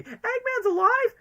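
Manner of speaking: very high, drawn-out mock shock; mock disbelief. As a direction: squeal it slowly as an astonished question. Acts out a shocked reaction.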